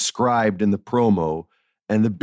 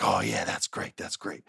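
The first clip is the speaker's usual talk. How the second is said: much softer, whispered approval; hushed whisper; 12 semitones lower, 10 dB quieter than usual. Retold or imitated. Writer whispering approval backstage next to Vince, hushed. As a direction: whisper it under your breath beside the boss.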